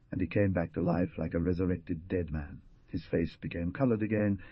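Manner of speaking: usual speaking voice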